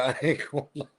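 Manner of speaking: talking through laughter; words dissolving into a laugh; can't hold the laugh in. Real, not acted. Laughing at chat's accent comments.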